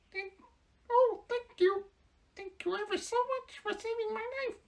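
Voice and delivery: very high, tiny squeak of a mouse; squeaky little voice. Acted. Field mouse thanking the Woodman in a tiny squeak. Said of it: "in a squeaky little voice".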